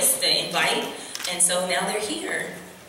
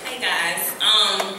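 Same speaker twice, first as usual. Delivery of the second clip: usual speaking voice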